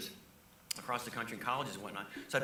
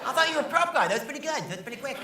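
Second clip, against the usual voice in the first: much higher and louder, amused host voice; Leno impression; 13 semitones higher, 10 dB louder than usual. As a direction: play the host impressed by a quick comeback. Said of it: "he was like".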